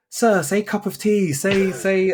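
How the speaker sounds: high-pitched imitation of pupils pleading; eager students. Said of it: "they'll always say".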